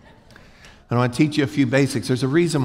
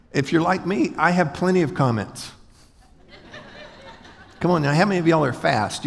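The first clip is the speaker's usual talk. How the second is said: ordinary talk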